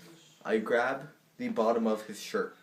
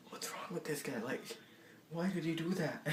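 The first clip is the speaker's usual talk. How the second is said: soft, under-the-breath disbelief; baffled aside; 4 semitones higher, 10 dB quieter than usual. Retold or imitated. Baffled aside to himself in acted retelling.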